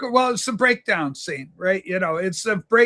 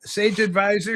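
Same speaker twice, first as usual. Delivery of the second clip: normal talking voice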